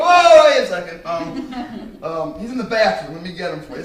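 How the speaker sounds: caught off guard. Retold or imitated. Almost blows cover on phone.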